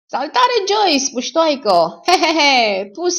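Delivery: high, loud, drawn-out hail with chuckles; boisterous greeting. Acted. Elmer greets the woman in the bedroom, boisterous call. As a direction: call out jovially into the next room, cut off at the end.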